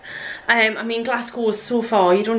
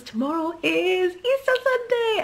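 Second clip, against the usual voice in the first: high, excited singsong announcement; 9 semitones higher than usual. Real, not acted. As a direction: build up to a high, excited finish. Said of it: "I'm so, so excited".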